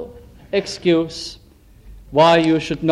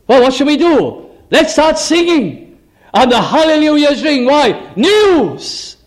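higher and louder, rejoicing heavenly crowd; excited heaven; 6 semitones higher, 3 dB louder than usual. Retold or imitated. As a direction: burst with glad news like the angels.

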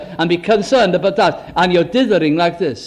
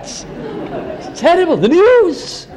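mock despair; 10 semitones higher, much slower than usual. Mocking complaint about the news.

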